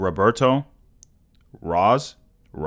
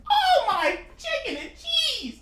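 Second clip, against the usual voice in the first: very high, softer squeaky voice; tiny squeal; 6 dB quieter than usual. Acted. Streamer amazed by donations, comic high squeal. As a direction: squeal it out at the very top of your voice.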